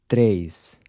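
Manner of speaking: Três with a closed E, like a short "tray"; Três, E kept tight and short. Shown as a demonstration. Teacher models Portuguese Três with a closed E. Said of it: "This E is a closed E".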